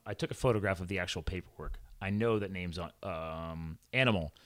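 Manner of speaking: ordinary talk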